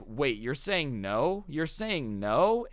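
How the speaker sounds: slow, drawn-out questioning, rising on "no"; mock disbelief. Chad can't compute being rejected by Alice, slow incredulous voice. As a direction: stretch it out as if the word won't compute.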